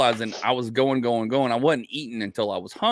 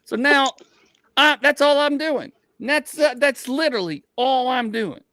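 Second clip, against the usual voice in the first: much higher, louder comic protest; worked-up insistence; 12 semitones higher, 4 dB louder than usual. Retold or imitated. Man declaring his only plan for the day, worked up. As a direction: insist on it, pitched way up. Acts out his single-minded resolve.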